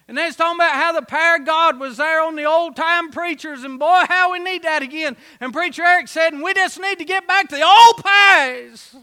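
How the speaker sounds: fired-up country preacher; preaching at full force. Acted. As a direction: build it up like a revival preacher.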